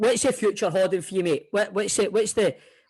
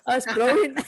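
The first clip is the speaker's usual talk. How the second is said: ordinary talk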